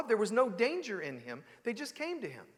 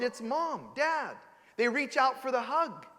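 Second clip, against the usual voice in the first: normal talking voice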